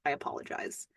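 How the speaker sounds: whispered under the breath; sheepish throwaway whisper; soft embarrassed aside; whispered apology. Real, not acted. Aside after her tangent.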